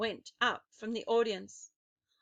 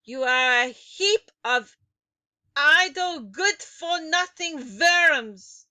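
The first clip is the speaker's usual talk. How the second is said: put-on shrill, loud witch voice with a foreign accent; witch's tirade; 6 semitones higher, 12 dB louder than usual. A narrator's reading. Grand High Witch scolding her witches, loud and accented. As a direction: spit out the insults like a furious witch. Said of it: "witch berating the audience".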